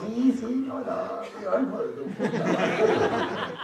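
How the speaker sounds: high, slow mock yes-yes then an exploding curse; mock Mexican outburst. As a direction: play the polite man who snaps. Imita al mexicano que estalla. (imitando al mexicano que estalla, agudo y riendo)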